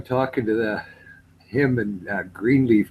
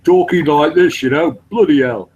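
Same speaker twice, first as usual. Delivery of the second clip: loud, higher-pitched put-on English accent; switched English accent; 5 semitones higher, 6 dB louder than usual. Shown as a demonstration. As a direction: switch from Canadian into broad English. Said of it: "I changed my accent from Canadian to".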